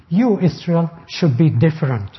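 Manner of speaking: You lifted and weighted, the rest settles down; stress on you. Shown as a demonstration. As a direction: make You the point of the sentence. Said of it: "There's the emphatic pronoun".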